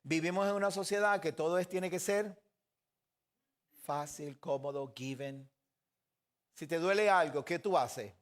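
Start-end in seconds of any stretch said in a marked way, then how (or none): none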